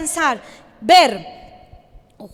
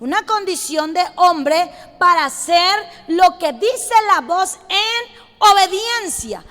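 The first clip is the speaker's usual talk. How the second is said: usual speaking voice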